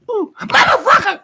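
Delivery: very high, loud falsetto swearing; imitating cursing mother. Imitating mother among high-end people, loud falsetto curse. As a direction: yelp it out like mom forgetting her company. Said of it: "and she's going".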